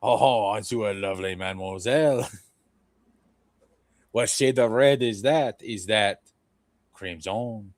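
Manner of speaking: a hint of a smarmy French flirt; lightly voiced French charmer. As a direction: purr it like a cheesy Parisian flirt. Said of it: "And then".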